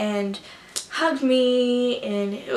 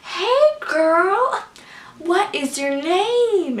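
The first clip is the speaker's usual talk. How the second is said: high, loud, drawn-out greeting; Ricky; 7 semitones higher, 5 dB louder, much slower than usual. Retold or imitated. Ricky greeting a fan at meet-and-greet, imitated high, drawn-out hello. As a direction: stretch it out in a playful singsong. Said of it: "Ricky was just like".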